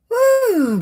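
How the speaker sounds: high, loud whoop; excited whoop. Real, not acted. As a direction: whoop for a friend joining the stream. Cheering a viewer's arrival.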